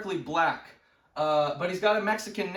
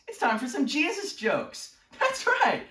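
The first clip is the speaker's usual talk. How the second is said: raised pitch, jumping high at the end; hyped announcement; 8 semitones higher than usual. Acted. Host introducing Jesus jokes, hyped announcement. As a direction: pump up the crowd like a show host. Hyping the next joke segment.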